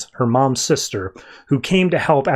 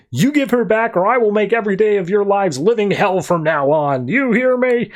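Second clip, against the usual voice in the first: higher-pitched mock tantrum threat; retold child's threat; 5 semitones higher than usual. Cindy demands Harley back from her dad, threatening. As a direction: imitate a bossy kid, rising on the last demand.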